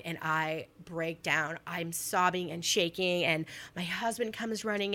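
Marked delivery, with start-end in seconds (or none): none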